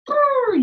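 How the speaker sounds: high loud lip-trill bray; hammed-up zebra noise. Shown as a demonstration. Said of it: "think about what sounds those animals make".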